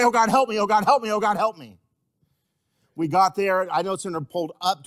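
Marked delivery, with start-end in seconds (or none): 0.0-1.8 raised, louder plea to God; retold desperate prayer. Preacher showing the congregation how to pray simply, urgent plea. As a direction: imitate a repeated cry for help in prayer. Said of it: "and then you say".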